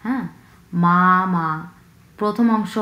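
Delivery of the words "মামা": মামা with both syllables long and flat; level first tone. Shown as a demonstration. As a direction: hold মামা on one steady pitch. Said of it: "দুটাই সমতল ভাবে উচ্চারণ হবে".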